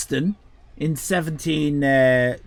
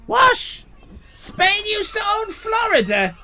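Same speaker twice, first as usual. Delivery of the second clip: shocked disbelief. Real, not acted. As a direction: caught completely off guard by the news. Surprised streamer reacts to chat.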